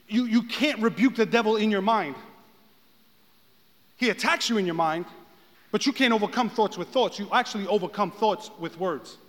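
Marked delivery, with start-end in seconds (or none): none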